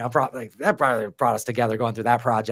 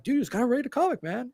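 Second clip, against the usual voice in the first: quoting his friend Ben in a much higher put-on voice; Ben's voice; 12 semitones higher than usual. Podcast host imitating artist friend Ben teasing him, high mock voice. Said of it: "He's doing that in that voice of his".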